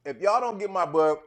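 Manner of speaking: talking while chewing; mouth full. Real, not acted. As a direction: talk through a mouthful. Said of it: "talking with my mouth full".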